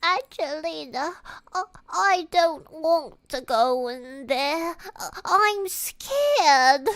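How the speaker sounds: high, slow, stammering British whine; hammed-up frightened cartoon mouse kid; complaining scared child. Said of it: "I wouldn't complain that much".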